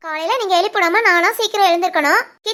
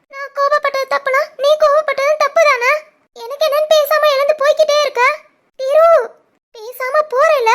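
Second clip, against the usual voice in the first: high, raised, hurt protest; upset protest; 5 semitones higher than usual. Acted. Wife protesting to her husband who walked out, raised and hurt. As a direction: throw it at his back as he goes.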